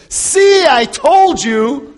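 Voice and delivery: loud, high, drawn-out complaint; indignant I-told-you-so. Acted. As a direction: crow it accusingly, stretching the words. Ahab's acted I-told-you-so complaint.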